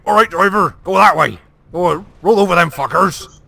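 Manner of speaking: gruff West Country commander urging the driver on; West Country accent. Retold or imitated. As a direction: bark orders in broad West Country. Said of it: "the most fucking West Country accent ever".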